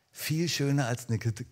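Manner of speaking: ordinary talk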